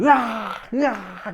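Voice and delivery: two short, high screams; mimicked screams. Frightened recruits on the dark trail, mock screaming. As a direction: yelp in mock terror, twice. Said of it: "그럼 애들".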